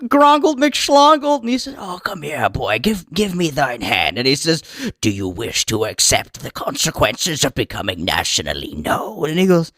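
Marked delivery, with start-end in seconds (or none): 0.1-1.4 higher, slow drawn-out goofy name; silly nervous newcomer. Acted. Nervous newcomer giving his name to judges, higher pitched. 1.8-4.2 low, soft solemn beckoning; ominous elder. Acted. Elder asking the newcomer for his hand, low and soft. 5.0-9.3 much lower, soft grave question; solemn elder. Acted. Elder testing the newcomer, deep and grave.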